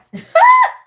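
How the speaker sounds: high, loud squeal of a laugh; shrieking laugh. Real, not acted. Shrieking laugh after "burnt out.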